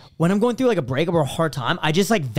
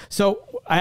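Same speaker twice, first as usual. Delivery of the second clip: ordinary talk, nothing marked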